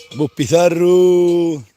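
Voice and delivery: drawn-out call to the animal; calling cattle. Real, not acted.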